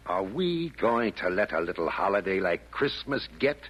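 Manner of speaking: ordinary talk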